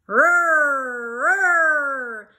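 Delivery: loud held siren wail; a loud, drawn-out mock siren; siren imitation.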